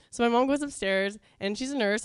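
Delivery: normal talking voice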